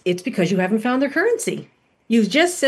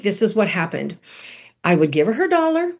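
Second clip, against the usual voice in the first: ordinary delivery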